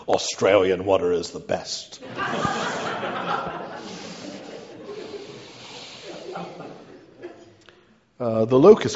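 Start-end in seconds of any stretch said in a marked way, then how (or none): 2.1-7.0 high breathy laughing fit; long laugh. Real, not acted. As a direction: let the laugh run out. Laughs at own water joke.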